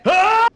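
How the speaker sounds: high, loud mock death cry; hammed-up dying yell; retold scream. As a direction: yelp like a man struck down. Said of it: "he's like".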